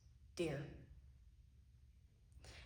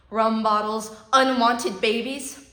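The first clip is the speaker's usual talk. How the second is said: loud, slowing, bitter; bitter outburst; 8 dB louder than usual. Acted. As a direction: spit it out in disgust. Bitter list of the projects.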